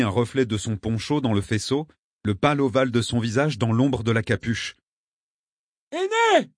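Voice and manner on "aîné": very high, strained name call; desperate calling. A narrator's reading. Traveller searching the bank by lamp, desperate calling. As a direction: shout the name to reach the shore.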